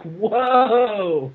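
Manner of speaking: usual speaking voice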